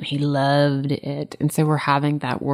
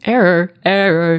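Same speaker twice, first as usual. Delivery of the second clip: louder, clipped "Error, error" as a dog's squawk; mock robotic squawk; 4 semitones higher, 7 dB louder than usual. Joking that the dog screamed error, loud mock squawk. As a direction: squawk it like an alarm. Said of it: "she was screaming".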